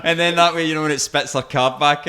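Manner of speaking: loud high laugh breaking through the words; loud laughter. Real, not acted. Driver telling the road rage story, loud laughter. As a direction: crack up laughing while telling it.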